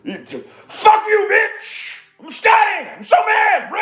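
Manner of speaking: very high, hammed-up angry screech; strained mock rage, screamed far above normal pitch; Scotty. Imitating Scotty punching the wall, over-the-top angry screeching. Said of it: "do an angry Scotty impression".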